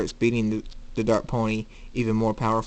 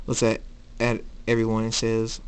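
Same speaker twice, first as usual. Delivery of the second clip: usual speaking voice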